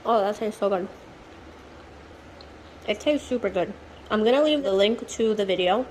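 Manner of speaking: talking while chewing; words round a bite of food; mouth full. Real, not acted. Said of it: "I'm talking with my mouth full".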